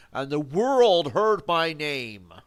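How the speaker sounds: loud, high, drawn-out proclamation; grandiose mock boast. Streamer spins a tall tale of triumph, grandiose loud boast. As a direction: proclaim it like a conquering hero.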